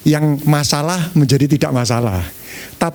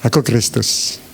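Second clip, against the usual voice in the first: low self-reminder; 7 semitones lower, slower than usual. Acted. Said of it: "kalau setiap kali tengkar itu gini".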